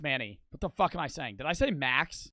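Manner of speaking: usual speaking voice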